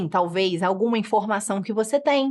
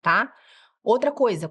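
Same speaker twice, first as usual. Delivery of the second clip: usual speaking voice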